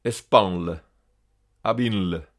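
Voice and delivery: "Espanl" and "abinl" ending in N plus L; final NL cluster. Shown as a demonstration. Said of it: "L L et T L se prononcent nl". (« Espanl », « abinl » finissant en nl)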